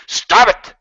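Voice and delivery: high, loud yelp of protest; mock outrage. Streamer objecting to being hit on, high indignant shout. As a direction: shout it high, cutting off the advances.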